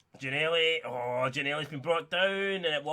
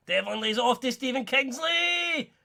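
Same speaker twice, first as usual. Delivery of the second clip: much higher, slow, stretched commentator cry; drawn-out excited call; 10 semitones higher, 3 dB louder, slower than usual. Real, not acted. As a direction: stretch the name out like a big-match caller. Free kick build-up call.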